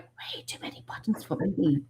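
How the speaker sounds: unvoiced whisper to herself; whispered aside. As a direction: whisper it like a private complaint. First take, muttered to herself.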